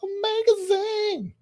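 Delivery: drawn-out squeaky high name; silly high sing-song. Pretend. Playfully stretching the name for her co-host, very high. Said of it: "Sorry, I won't do that again".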